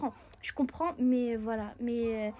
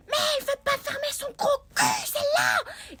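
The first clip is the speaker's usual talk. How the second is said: put-on angry boy; 6 dB louder than usual. Boy shouting at the girl, hammed-up rage. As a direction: bellow it like an outraged kid. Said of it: "il a dit".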